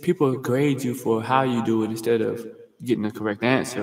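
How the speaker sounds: normal talking voice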